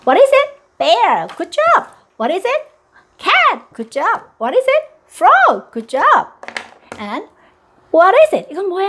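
high, bright teacher-mom voice; high cheerful quiz. Shown as a demonstration. Mom quizzing toddler on colors, high and cheerful. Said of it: "다시 한번 할게요".

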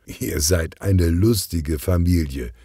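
ordinary delivery

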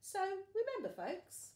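high, loud showman call; mock announcer. A narrator's reading. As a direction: address the folks like a host. Storyteller addresses folks.

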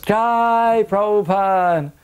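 long, high, drawn-out cheer. Retold or imitated. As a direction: call it out in triumph. Everyone cheers the guru.

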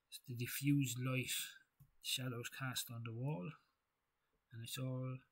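normal talking voice

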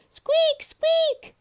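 very high, loud squeaks; piercingly high mouse roar; roaring mice. A narrator's reading. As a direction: squeak at full volume like noisy mice. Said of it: "they'd roar at each other".

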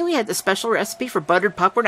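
ordinary delivery, nothing marked

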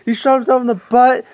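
high-pitched imitation of frantic kids; mock-frantic cries, pitched high; panicked yelling. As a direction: yell it like a kid begging an officer for help. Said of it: "They're yelling at the officer".

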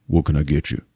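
low, subdued bartender voice; Kylo Ren. Retold or imitated. Bartender brother greeting a customer, low and subdued. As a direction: say it low and understated, in character. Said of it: "that's how he talks this entire movie".

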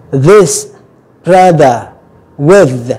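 "This, rather, with" each with buzzing TH; voiced TH. Shown as a demonstration. Teacher giving examples with vocal cords vibrating, voiced TH. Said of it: "mình rung cái dây thanh quản lên".

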